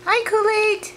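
high, loud sing-song hello; pet talk. Real, not acted. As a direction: call up high and bright to the parrot. Greeting the parrot again.

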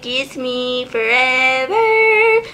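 very drawn-out, put-on creature plea; Bellsprout flower voice. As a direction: stretch the last word into a rising squeal. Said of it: "It's like".

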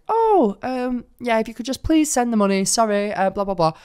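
high, awkward mock caller voice; flustered scammer. Scammer caught lying to his target, high flustered imitation.

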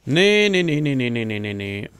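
panicked high shouts fading down; frantic no's while fleeing, calming; being chased. Real, not acted.

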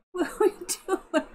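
amused, breaking into laughter; laughing. Real, not acted. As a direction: giggle while asking the cat. Cracking up at the cat's antics.

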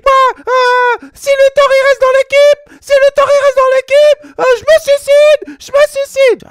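voix suraiguë et forte, lente, Messi hystérique; Messi en crise. Retold or imitated. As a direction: menacer de tout quitter d'une voix perchée. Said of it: "Parce qu'il a une voix un peu aiguë". (Messi hurle dans le vestiaire, voix aiguë)

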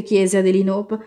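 ordinary delivery

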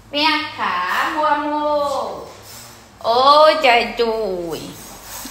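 slow loving coo to call the dog over; tender cooing. Real, not acted. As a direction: coax the dog in gently.

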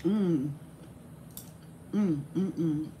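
ordinary talk, nothing marked

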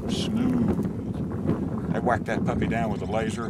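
loud, punchy showman boast; hammed-up enthusiasm. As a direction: sell it like a TV host. Hammed-up nature guide boast.

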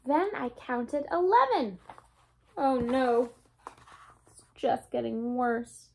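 lively storybook read-aloud, bouncy and bright; reading to children. A narrator's reading. Teacher reading a Dr. Seuss book to her class, lively read-aloud. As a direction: read it like story time for little ones.

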